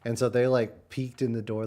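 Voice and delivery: usual speaking voice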